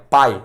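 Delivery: PAI low and firm, through the mouth; oral diphthong. Shown as a demonstration. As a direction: say PAI without any nose. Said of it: "Tu não tá usando o nariz".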